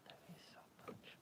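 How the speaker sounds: "love you so much" barely whispered. Real, not acted. Mama whispering love to her sleepy cat, tiny whisper. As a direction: breathe "love you so much" almost silent.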